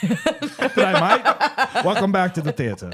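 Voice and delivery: high, laughing fake Aussie accent; hammed-up Australian cinema welcome through laughter; mock Australian advert. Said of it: "Visit A M C".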